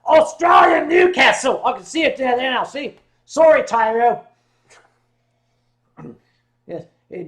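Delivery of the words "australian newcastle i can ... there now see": excited shout. Real, not acted. Realizing the viewer means Australia, shouting it out loud. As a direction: bellow it out as the penny drops.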